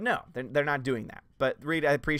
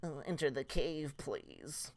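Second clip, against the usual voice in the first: soft, breathy, wimpy villain voice; mock weak Jafar; 8 dB quieter, slower than usual. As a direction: play Jafar as a limp whispering wimp. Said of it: "Jafar does not go".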